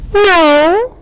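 high, drawn-out little no; high objection. A narrator's reading. Ant replying to the star, high-voiced objection.

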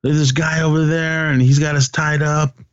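high-pitched, urgent mock plea; retold plea for help. Peter telling the hunters they were kidnapped, high retold plea. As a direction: act out a captive flagging down help. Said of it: "and was telling him".

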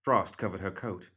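ordinary delivery, nothing marked

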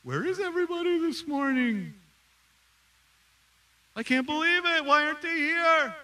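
high, loud, indignant mock grumbling; mock indignant complaint. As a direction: grumble like a pastor upset about empty pews. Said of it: "I know my heart at times".

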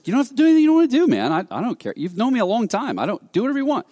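high, rapid brush-off; acting out a fed-up "suit yourself"; retold exasperated dismissal. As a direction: wave him away in a rush of words. Acted heated reply to a reluctant friend.

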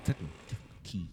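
breathless whispered repeat. Shown as a demonstration. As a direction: whisper it playfully. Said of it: "say that shit three times".

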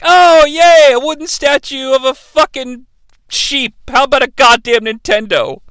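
put-on whiny kid unimpressed by the present; disappointed kids. Kids get a wooden sheep, mock sarcastic cheer. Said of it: "these kids are like".

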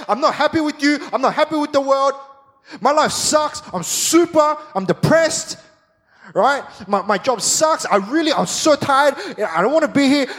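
high, loud frustrated venting; a hammed-up moan of a tired believer; honest complaint to God. Worshipper admitting to God he didn't want to come, mock venting. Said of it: "you come to church and you're like".

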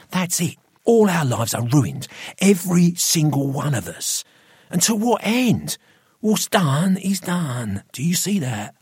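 high, urgent, forceful arguing; heated, pressuring persuasion; domineering Gregory, insistent. A narrator's reading. Gregory argues hard with Roy.

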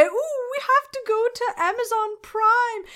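high-pitched mock "ooh"; mock viewer exclamation. Host imitates viewers pausing the podcast, high mock exclamation. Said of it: "did you pause and say".

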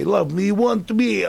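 high, loud, drawn-out character line; Seinfeld landlord character. Acted. Actor replaying his landlord line from the episode, hammed-up. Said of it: "I got to say".

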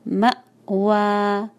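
very slow मअवा with a clear stop on the ma; pure catch. Shown as a demonstration. Teacher shows the pure hamza catch. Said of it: "pure झटका".

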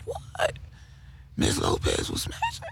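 breathless high whisper of shocked gossip. Retold or imitated. Kid gossiping about a teacher in a shocked whisper. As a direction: hiss the scandal in disbelief.

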